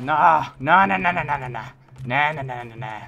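repeated nah, shouted high; loud frustrated nah. Real, not acted. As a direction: yell nah over and over. Frustrated chant in game.

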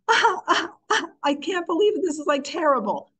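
high mock sobbing, easing down at the end; hammed-up tearful wail; acted emotional outburst. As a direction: imitate crying out in pain, quick and high. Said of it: "That kind of emotional".

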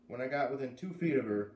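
ordinary delivery, nothing marked

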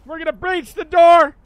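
loud tactical call; police pounding door. Pretend. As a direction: shout the breach order. Announcing breach to team.

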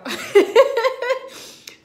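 loud, high burst of laughter; real helpless cackling laugh. Real, not acted. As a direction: burst out laughing through the tears. Laughs at own crying.

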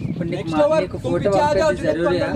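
angry. Real, not acted. Heated phone argument.

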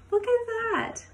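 gasp, then a high delighted exclamation; surprised gasp. Acted. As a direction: act amazed for the children. Surprise at teacher picture.

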